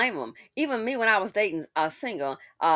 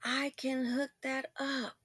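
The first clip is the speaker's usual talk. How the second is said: soft echo; 4 semitones higher, 9 dB quieter, slower than usual. Retold or imitated. Last echo of the line, said softly.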